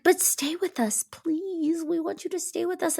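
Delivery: high, soft, eerie pleading; high soft ghostly plea. Retold or imitated. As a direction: coax them to stay, high and soft. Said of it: "and they're like".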